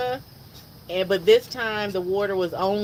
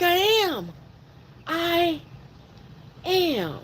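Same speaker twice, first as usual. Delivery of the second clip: insistent reply; 8 semitones higher, slower than usual. Retold or imitated. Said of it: "And I'm like".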